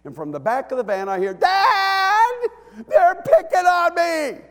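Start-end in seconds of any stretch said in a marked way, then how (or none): 1.3-4.4 put-on high whining child's cry; whiny daughter. Little girl in the van whining to dad, high and tearful.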